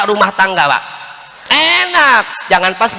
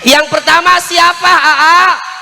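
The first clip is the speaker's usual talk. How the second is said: much higher, eager question; curious wife; 8 semitones higher, slower than usual. Retold or imitated. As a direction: ask like a coy wife. Voicing the wife asking.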